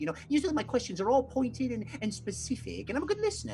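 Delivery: high, fast, stammering toady voice; nervous Loudmouth. Acted. Nervous sailor rambles to the captain, high and stammering. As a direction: babble it out high and eager to please. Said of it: "Loudmouth answers Whitebeard".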